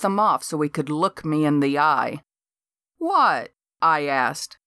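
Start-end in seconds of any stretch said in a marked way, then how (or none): 3.0-3.5 high, feigned surprise; mock innocent what. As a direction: play dumb.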